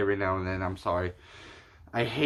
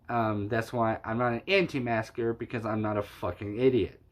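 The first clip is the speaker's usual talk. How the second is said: usual speaking voice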